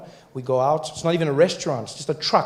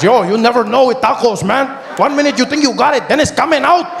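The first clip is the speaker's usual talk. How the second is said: high, loud vendor shout; a high, loud yelled warning about tacos; taco guy; 9 semitones higher, 8 dB louder than usual. Retold or imitated. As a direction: warn him like a street vendor who knows. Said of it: "He says".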